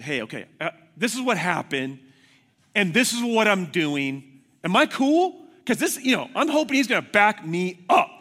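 agitated, eager self-justifying; retold worked-up phone call. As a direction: build from hesitant to high, loud pleading. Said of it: "I called up my pastor and I said".

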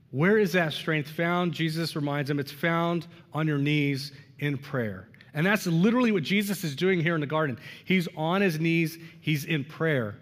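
ordinary talk

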